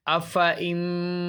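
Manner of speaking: drawn-out recitation, alif left silent; slow tajweed recitation; Quranic recitation. Shown as a demonstration. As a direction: recite it slowly with the alif unspoken. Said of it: "अलिफ यहाँ पढ़ा नहीं जाएगा".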